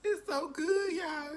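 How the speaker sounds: high and loud, bursting with delight; rapturous cry. Real, not acted. Reviewer raving about the sandwich, high rapturous cry. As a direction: cry it out to the viewers. Swooning over the sauce.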